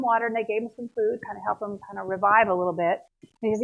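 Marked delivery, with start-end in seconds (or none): none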